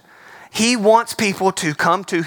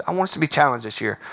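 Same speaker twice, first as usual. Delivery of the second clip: usual speaking voice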